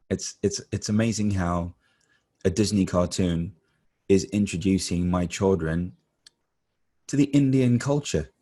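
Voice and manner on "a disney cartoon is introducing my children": usual speaking voice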